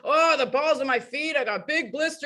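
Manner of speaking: badly faked Russian accent, complaining. Imitating runner showing his blistered feet, Russian accent. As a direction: groan through a half-hearted Russian accent. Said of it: "I can't do the accent".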